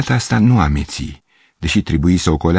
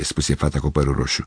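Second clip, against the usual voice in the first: plain reading voice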